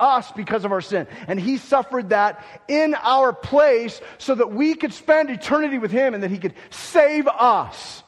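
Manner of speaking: a preacher in full flight; preaching at full force. Acted. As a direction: build to loud, high pulpit fervour. Preaches the cross and resurrection.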